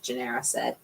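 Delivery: ordinary delivery, nothing marked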